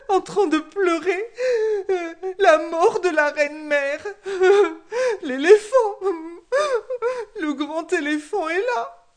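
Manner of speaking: high, loud, wailing fake sobs to the king; sobbing tattletale warthog. A narrator's reading. The warthog snitches to the king, wailing loud fake sobs. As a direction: wail it like a crocodile-tear snitch. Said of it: "d'aller dire au roi en pleurant davantage".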